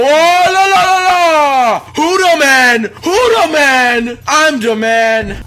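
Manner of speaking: high, loud, stretched-out victory holler; triumphant shout. Real, not acted. Gamer crowing over his game, triumphant shout. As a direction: holler it like a hyped champion. Boasting after success in game.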